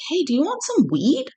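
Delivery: high, easygoing pitch to a stranger; casual offer. Retold or imitated. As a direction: toss it out like a friendly favour. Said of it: "and I was like".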